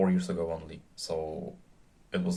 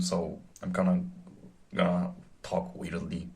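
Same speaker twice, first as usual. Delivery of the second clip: ordinary delivery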